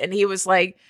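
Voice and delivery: usual speaking voice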